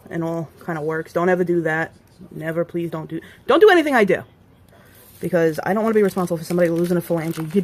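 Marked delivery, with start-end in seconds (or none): 3.5-4.2 louder, higher insistent plea; emphatic warning. Real, not acted. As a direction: really drive the warning home. Safety warning to viewers.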